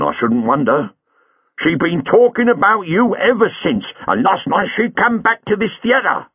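a burly cabbie squaring up; cab driver Buller. A narrator's reading. Buller describes wife's change.

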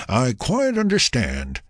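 slow, high reply after a chuckle; chuckle, then answer with amusement; chuckling. A narrator's reading. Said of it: "he chuckled".

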